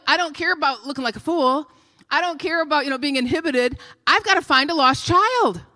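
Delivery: usual speaking voice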